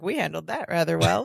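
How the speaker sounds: quiet, low, self-satisfied; smug aside. Retold or imitated. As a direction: mutter it pleased with yourself. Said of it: "He's like".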